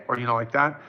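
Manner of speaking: usual speaking voice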